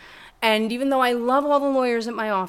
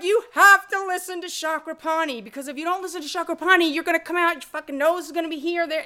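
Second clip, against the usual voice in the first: higher, louder insistent friend; mock urgent warning, higher and louder; 9 semitones higher, 4 dB louder than usual. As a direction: warn him urgently, hammed up.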